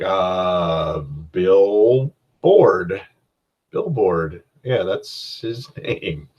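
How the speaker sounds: slow, drawn-out stalling; hesitant improvising. Acted. Game master caught without a name, hesitant. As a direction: grope for it, then trail into a laugh.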